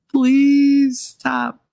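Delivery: high, drawn-out imploring plea; desperate pleading. Retold or imitated. Mother at the end of her patience, begging desperately.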